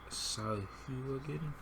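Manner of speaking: "Sahuagin" stretched out very slowly and softly, questioning; slow pronunciation attempt. Shown as a demonstration. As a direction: carefully sound out "Sahuagin". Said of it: "figure out how to pronounce it".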